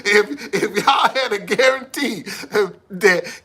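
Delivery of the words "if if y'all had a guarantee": words pushed out through laughter; laughing. Real, not acted. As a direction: still chuckling as he starts the sentence. Still laughing after the joke.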